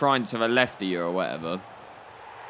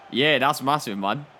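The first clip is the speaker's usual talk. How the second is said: ordinary talk, nothing marked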